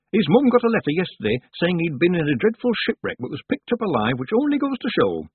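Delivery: high, loud village gossip; gossiping village woman. A narrator's reading. Woman telling Tiffany of a rescued boy, high and gossipy. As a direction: pitch it up and tell it eagerly.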